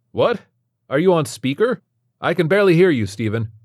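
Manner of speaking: high, loud chirpy doctor voice; a cheery doctor straining to hear; chirpy doctor on speaker. A narrator's reading. Doctor on speakerphone straining to hear, chirpy and loud. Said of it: "chirpy and grating voice".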